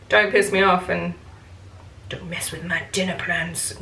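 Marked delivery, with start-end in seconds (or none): 2.1-3.7 hushed, whispery playful threat; whispered mock warning. Storyteller warns about her dinner plans, whispered mock warning. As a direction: warn in a mock-menacing whisper.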